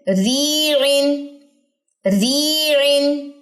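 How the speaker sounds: ریعن with the ی held slowly for two seconds; long vowel. Shown as a demonstration. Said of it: "ری بھی لمبا ہوا کتنا؟ دو second". (یا مد کے ساتھ ریعن)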